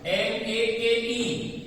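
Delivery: each letter slow and separate; spelled slowly. Shown as a demonstration. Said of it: "spelling out the letters". (अक्षर-अक्षर धीरे बोलें)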